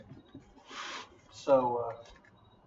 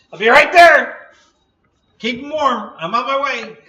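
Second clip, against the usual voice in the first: excited; 10 semitones higher, 17 dB louder than usual. Pretend. Joking eagerness over enchiladas.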